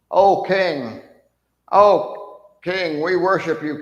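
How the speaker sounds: high, loud, fawning sarcasm; sarcastic mock worship. Kneeling soldiers pretending to worship him, sarcastic. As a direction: grovel with exaggerated fake reverence. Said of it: "sarcastic voices".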